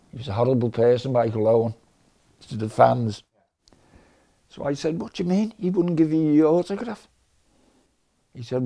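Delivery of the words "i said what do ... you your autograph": higher-pitched retold disbelief; incredulous questioning. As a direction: question it in mock disbelief, voice lifted. Incredulous at refused autograph.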